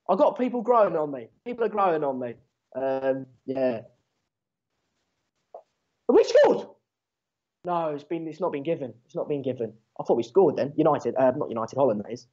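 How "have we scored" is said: sudden high, slow, louder cry of hope; excited outburst. Real, not acted. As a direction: yelp it out as if the ball went in. Goal chance during live match.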